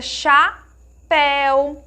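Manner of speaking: chapéu slowly split, open "péu"; open vowel. Shown as a demonstration. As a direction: pause after "cha", then a long open "péu" in chapéu. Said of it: "tem um som mais aberto". (chapéu com "péu" bem aberto)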